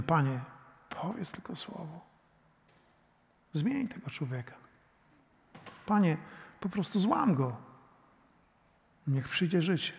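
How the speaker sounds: soft, hushed plea; quiet prayer. Acted. Believer quietly praying God to change a hard neighbour.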